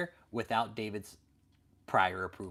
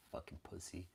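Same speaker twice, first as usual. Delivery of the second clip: normal talking voice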